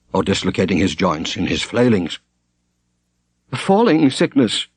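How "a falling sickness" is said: short, higher clipped diagnosis; terse. A narrator's reading. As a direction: snap it out briefly, a touch raised. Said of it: "said Brother Edmund tersely".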